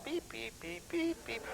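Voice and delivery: high, soft beeping like button tones; imitated beeps. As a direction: mimic the tone generator chirping high. Imitating the cop's box tones.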